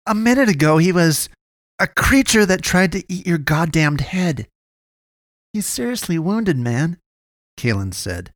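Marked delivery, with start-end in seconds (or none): 0.1-4.5 high-pitched, heated argument; countering. A narrator's reading. Friend warning the boy about the creature, countering. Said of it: "Gunnar countered". 5.5-7.0 high-pitched protest; pleading. A narrator's reading. Boy arguing for the wounded man, pleading.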